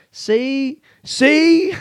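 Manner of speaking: See? at a rising pitch, breaking into laughter; laughing. Real, not acted. Comedian to his listeners, laughing at his own mood swing. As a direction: crack up at yourself. Cracking up at himself.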